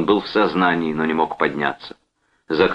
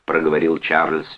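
usual speaking voice

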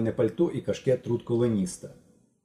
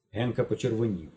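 usual speaking voice

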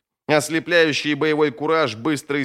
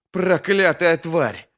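slow, higher-pitched angry oath; disgusted curse; 6 semitones higher, much slower than usual. Acted. Fighter cursing the beheaded body, slow and angry. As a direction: draw the curse out with loathing.

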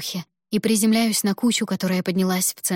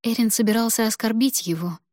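usual speaking voice

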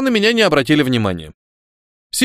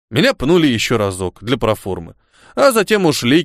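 plain reading voice

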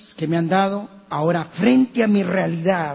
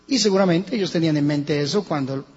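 usual speaking voice

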